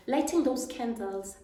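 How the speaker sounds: ordinary talk, nothing marked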